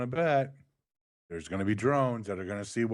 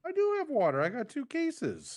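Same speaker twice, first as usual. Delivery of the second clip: much higher defensive protest; voice goes high. Real, not acted. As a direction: squeak it out defensively. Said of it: "why did your voice get so high".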